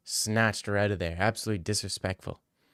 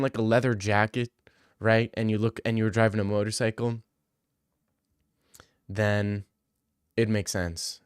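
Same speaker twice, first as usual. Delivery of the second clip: ordinary talk, nothing marked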